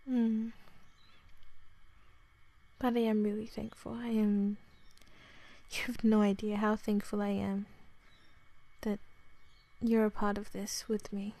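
ordinary talk

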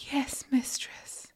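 high, slow, soft whisper; meek submission. A narrator's reading. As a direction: answer meekly in a small whispery voice. Submissive answers mistress.

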